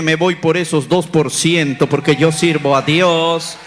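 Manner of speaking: loud, slow, firm declaration; a preacher loudly defying the doctor's odds; defiant proclamation. Retold or imitated. Defiant faith told to doctor.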